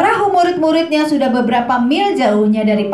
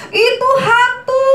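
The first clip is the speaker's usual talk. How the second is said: high, slow scared cry; frightened shout; 9 semitones higher than usual. Pretend. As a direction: shout it in terror. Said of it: "terkejut dan berseru".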